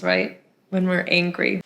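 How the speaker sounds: ordinary delivery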